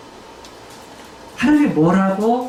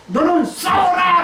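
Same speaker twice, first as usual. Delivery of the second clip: commanding shout; 7 semitones higher than usual. Acted. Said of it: "라는 말이에요".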